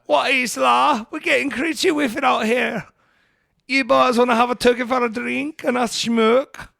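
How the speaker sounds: put-on over-the-top Scouse accent; Scouse accent impression. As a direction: ham up a rowdy Scouser inviting the boys. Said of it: "That's what they talk like there".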